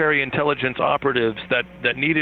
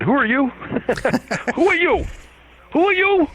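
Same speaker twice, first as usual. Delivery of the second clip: hammed-up Michael Moore with a New York accent; mock Michael Moore; 12 semitones higher than usual. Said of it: "And he didn't say it like that".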